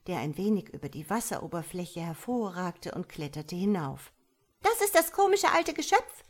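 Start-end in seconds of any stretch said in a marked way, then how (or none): 4.6-6.2 very high, loud tadpole voice; squeaky-high jeer from small water creatures; little creatures calling out. A narrator's reading. Tadpoles mock their old mother, calling out high. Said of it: "riefen die Tierchen im Wasser".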